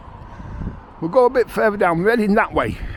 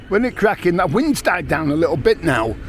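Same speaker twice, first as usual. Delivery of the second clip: ordinary talk, nothing marked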